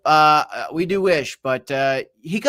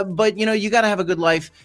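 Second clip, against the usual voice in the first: normal talking voice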